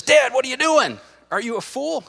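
high, exasperated movie-hero cry; Indiana Jones. Acted. Son questioning his dad under plane attack, high exasperated cry. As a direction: cry out loud, then drop the volume. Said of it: "Indiana Jones says".

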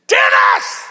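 explosive, very loud yell of a name; screamed back. Retold or imitated. Preacher fed up with the man's ranting, screaming back. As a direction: roar the name to cut him off. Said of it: "And I screamed back".

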